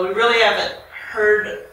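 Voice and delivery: usual speaking voice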